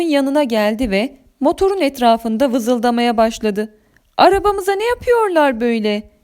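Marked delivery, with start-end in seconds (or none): 4.2-6.1 tiz ama fazla yükselmeyen telaş; korkulu bağırış. A narrator's reading. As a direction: hafif korkuyla, tiz sesle sor. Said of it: "diye bağırdım korkuyla". (ölçülü bir korku çığlığı)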